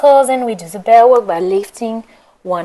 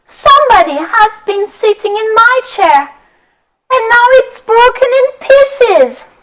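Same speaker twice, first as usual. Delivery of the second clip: put-on high, louder baby bear voice; 10 semitones higher, 5 dB louder than usual. Baby Bear finding his chair broken, high and loud. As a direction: wail it as little baby bear. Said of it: "Baby Bear says".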